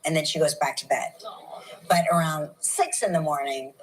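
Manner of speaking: normal talking voice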